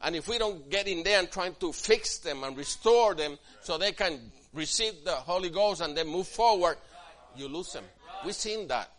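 loud, impassioned sermon cry; fiery preaching. Real, not acted. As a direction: preach it out hard to the congregation. Preaching at full force.

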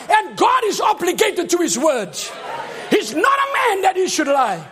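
loud fervent preacher's cry; preaching. Acted. Preacher shouting a prayer to the congregation. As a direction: drive the prayer home to the church.

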